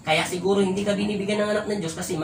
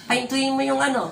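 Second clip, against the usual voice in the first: mocking commenter; 6 semitones higher than usual. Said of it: "sinatanong mo".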